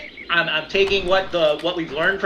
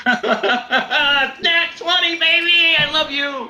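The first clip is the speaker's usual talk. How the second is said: high, drawn-out victory shout; real elated yell after a perfect roll; jubilant cheer; 11 semitones higher, much slower than usual. Real, not acted. Player celebrating a nat twenty, high jubilant shout.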